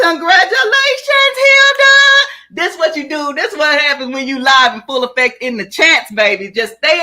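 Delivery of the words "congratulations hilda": high, loud celebratory shout; real excited shout, pitched way up; jubilant congratulations. Real, not acted. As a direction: cheer the winner at the top of your voice. Congratulating the winner.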